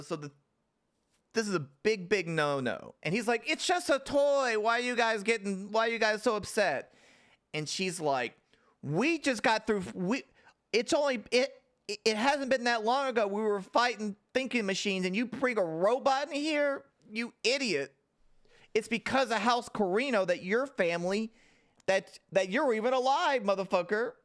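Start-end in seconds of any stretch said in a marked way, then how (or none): 3.5-6.9 defensive whine. Retold or imitated. Desmond voiced defending toy. 8.8-24.1 mock angry rant. Princess's angry rant voiced.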